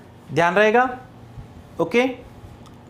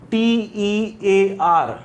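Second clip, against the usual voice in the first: slow spelling; much slower than usual. Shown as a demonstration. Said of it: "spelling out letters slowly".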